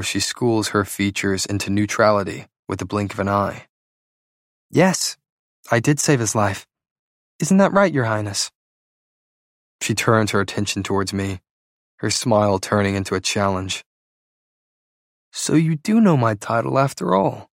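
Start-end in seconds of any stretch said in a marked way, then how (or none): 4.7-8.5 higher-pitched, teasing challenge to a prince; pointed challenge. Acted. 15.3-17.5 higher-pitched, lightly mocking retort; sarcastic. A narrator's reading. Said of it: "Sarcasm coats my words".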